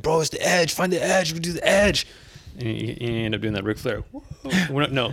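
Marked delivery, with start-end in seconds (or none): 0.0-2.1 high excited bro voice; imitating a hype bro. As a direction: hype up the edge like a coach bro. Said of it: "you always telling me that".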